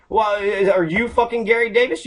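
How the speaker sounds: ordinary talk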